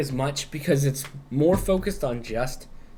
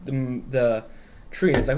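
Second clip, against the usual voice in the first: normal talking voice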